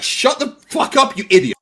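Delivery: imagining telling him off; mock angry snap. Speaker to his opponent, an imagined angry outburst. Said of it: "I find it very hard to not just be like".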